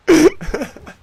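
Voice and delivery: high burst of laughter; real high, loud cackle. Real, not acted. Laughing at tattoo joke.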